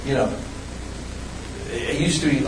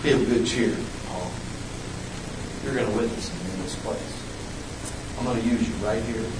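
voice of the Lord; 6 semitones lower, 11 dB quieter than usual. Retold or imitated. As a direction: lower and softer, calm voice of the Lord. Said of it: "And he says".

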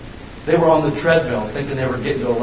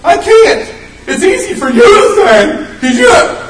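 high, loud, laughing mock whine of a scared believer; whiny excuse voice; 9 dB louder than usual. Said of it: "You're like".

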